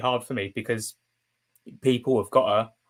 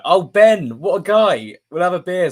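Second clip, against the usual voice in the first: delighted; 6 semitones higher, 9 dB louder than usual. Real, not acted. Thanking new Patreon subscriber.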